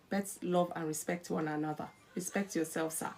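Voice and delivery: low, soft telling-off of a husband; quiet admonishment. Acted. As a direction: admonish him softly and low. Admonishing husband, then wife in contrast.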